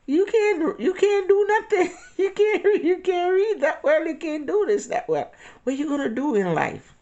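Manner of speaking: high, loud mocking mother's voice; mock-scolding mom, high and loud, laughing through it. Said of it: "she used to say".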